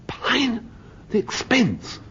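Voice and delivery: high-pitched mock dismay; aghast outcry. Husband to wife about a pine door, high aghast outcry. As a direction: yelp it in hammed-up horror at the cost. Acted outcry over door's cost.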